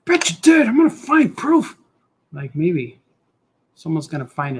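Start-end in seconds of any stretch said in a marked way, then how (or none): 0.0-1.8 teasing accusation. Pretend. Mock accusing voice, high and loud.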